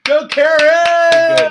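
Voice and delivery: big cheer, high and loud; rallying whoop for a nervous guest; cheering shout. Real, not acted. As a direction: cheer her on with everything.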